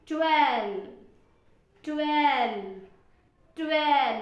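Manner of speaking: ट्वेल्व said loud and clear, the L sound plain to hear; clear pronunciation. Shown as a demonstration. Teacher drilling students on ट्वेल्व, loud and clear. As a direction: sound out ट्वेल्व firmly so the L rings. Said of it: "pronounce करो".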